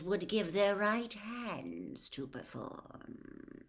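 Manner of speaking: lightly voiced cold, commanding lord; calm menace handing out orders; a dark lord instructing his servant; cold master. A narrator's reading. The cold lord promises a task.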